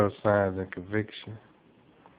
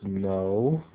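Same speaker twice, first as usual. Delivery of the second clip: confused. A narrator's reading. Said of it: "World replies confused".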